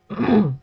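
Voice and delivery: throat clear. Real, not acted. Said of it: "sorry about that".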